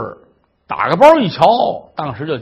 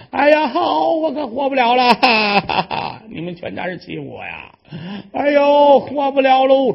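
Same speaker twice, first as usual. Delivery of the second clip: mock wailing tantrum; 7 semitones higher than usual. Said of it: "这一撒泼".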